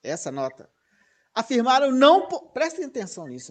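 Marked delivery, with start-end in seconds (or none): 1.3-2.5 NÃO hit high and loud; raised, insistent weight on "não"; emphatic stress on NÃO. Real, not acted. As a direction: punch "não" as if in bold type. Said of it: "tá em negrito isso".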